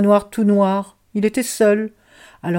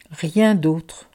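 ordinary delivery

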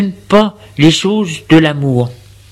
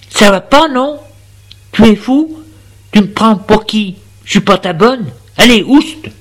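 indignée; 5 semitones higher than usual. Acted. Elle le chasse, indignée.

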